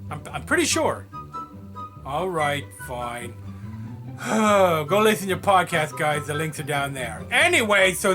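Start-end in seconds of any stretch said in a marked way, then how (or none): 3.4-4.8 long, resigned sigh; reluctant sigh. Acted. [name] giving in to doing podcast shout-outs, reluctant sigh. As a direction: let out a put-upon sigh.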